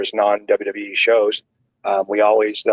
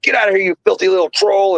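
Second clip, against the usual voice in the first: mock angry shout; 9 semitones higher than usual. Edge chasing Hornswoggle from the hotel room, mock angry yell. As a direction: chase a little troll out of your room.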